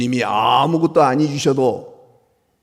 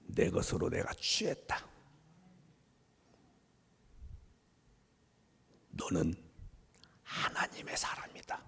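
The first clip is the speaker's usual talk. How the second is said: hushed; 4 semitones lower, 14 dB quieter than usual. Acted. God's tender words to His people.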